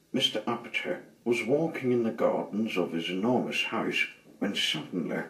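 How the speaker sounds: usual speaking voice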